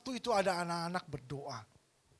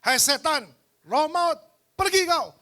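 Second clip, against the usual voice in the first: loud, high commanding prayer casting out a spirit; authoritative rebuke; 8 semitones higher, 10 dB louder than usual. Retold or imitated. Pastor rebuking the spirit of death over a comatose man, loud commanding cry. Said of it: "Saya berdoa dengan otoritas".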